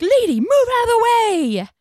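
high, loud, drawn-out yell; commanding shout. Retold or imitated. Rescuer ordering the cat's owner aside, urgent shout. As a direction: shout it like a self-appointed hero taking over.

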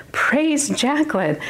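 high, drawn-out mock acclaim; hammed-up self-praise, slowly. Mock praise of herself, disowned.

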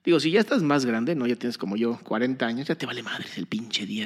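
usual speaking voice

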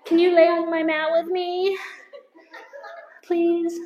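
high, loud, mock-needy plea; needy pleading. Preacher mocking those who want spooning in affliction, needy pleading. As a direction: beg for company, hammed-up. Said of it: "like to be spooned in our affliction".

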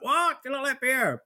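high, incredulous squeal of surprise; mock disbelief. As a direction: play shocked that someone doesn't like beer. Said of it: "you two would both be like".

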